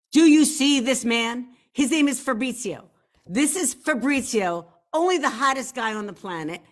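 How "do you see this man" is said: repeating the question louder and slower for emphasis. Shown as a demonstration. To viewers, showing the guide's photo, louder and slow. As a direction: lean in hard on the repeat, slowly.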